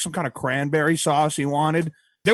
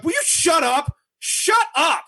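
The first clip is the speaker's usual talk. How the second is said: mock yell; 13 semitones higher, slower than usual. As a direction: pretend furious shout at a priest. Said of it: "I wish I would have just looked over and be like".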